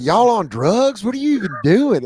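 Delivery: hammed-up drunk indignation; wine-drunk friend. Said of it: "he was wine drunk".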